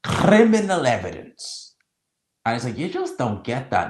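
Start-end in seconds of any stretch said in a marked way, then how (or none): 0.0-1.7 "criminal evidence" pitched high, loud and slow; imitating the commentator's heavy stress on "criminal evidence"; emphasized phrase. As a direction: lean hard on "criminal evidence" like a grand commentator. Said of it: "and he emphasized this bit".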